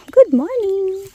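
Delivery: very high sing-song greeting. Real, not acted. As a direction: coo good morning to the animals. Greeting animals, squeaky.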